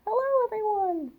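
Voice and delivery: high put-on wolf voice, waving hello; wolf greeting. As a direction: greet the kids as the storybook wolf. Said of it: "See him waving at you?".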